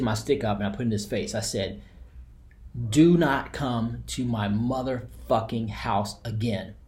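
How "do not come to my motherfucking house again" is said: spaced-out, deliberate command; retold threat, word by slow word; slow, emphatic warning. Man confronting a door-knocker at the Kingdom Hall, slow emphatic warning.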